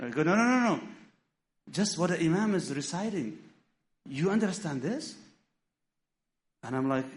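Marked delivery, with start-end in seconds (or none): 0.2-5.1 urgent insistence. Retold or imitated. Imam's student corrects him urgently.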